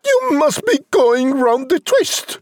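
high-pitched, dismissive mum voice; high exasperated mother character; scornful hissing mother. Acted. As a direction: scoff at the boy, pitched well up. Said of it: "his mother hissed".

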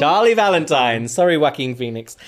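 loud, proclaimed winner's name; mock awards presenter. Host naming co-host as Oscar winner, loud mock presenter. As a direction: announce the winner to the hall.

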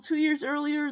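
ordinary talk

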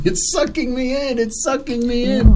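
high-pitched mock cry for help; Joe Franco. Brother calling out from the hot tub, mock alarmed wail. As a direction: imitate a panicked kid in trouble. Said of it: "you hear Joe Franco going".

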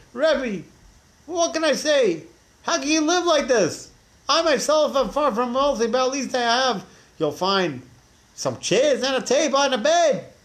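exasperated peasant demanding an answer; angry villager. Retold or imitated.

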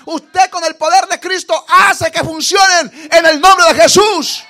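loud, slow shout; shouted proclamation. Acted. Preacher shouting the climax at the church. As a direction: bellow the name of Jesus.

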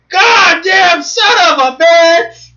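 slow, high, loud cursing; furious shout. Retold or imitated. Quoting the kids' swearing, shouting. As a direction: drag the curse out in fury.

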